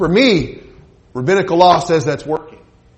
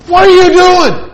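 sharp, high, loud indignant challenge; an outraged official snapping at Jesus; a synagogue ruler scandalised by a healing; indignant outburst; 12 semitones higher, 9 dB louder than usual. Acted. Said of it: "answered with indignation".

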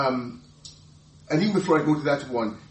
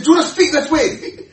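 higher, louder, laughing rebuke; 9 semitones higher, 8 dB louder than usual. Retold or imitated. Peter rebuking Jesus, loud laughing scold. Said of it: "He said, he said".

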